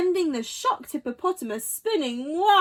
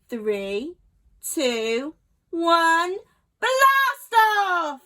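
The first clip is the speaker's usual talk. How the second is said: building countdown; 2 semitones higher, 5 dB louder, much slower than usual. A narrator's reading. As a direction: narrator counts the launch, building to a shout. Narrator counts down.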